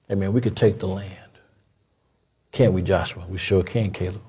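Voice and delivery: low, soft, confident spies talking to each other; two friends sure they can take the land; Joshua and Caleb. Retold or imitated.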